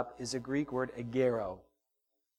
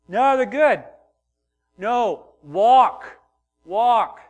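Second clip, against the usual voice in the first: higher, louder brush-off in a lightly voiced teen; mock teenager; 7 semitones higher, 12 dB louder, much slower than usual. Teenager at the shoe store brushing off the parent, light mock teen voice. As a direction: toss it off like a bored teen. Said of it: "your teenage son or daughter is going".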